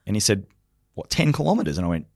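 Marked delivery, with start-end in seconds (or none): 0.9-1.8 mock disbelief, pitched up high; high-pitched, lightly acted incredulity; incredulous question. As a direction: echo it back as if it's absurd. Retelling the colonel's disbelieving reply.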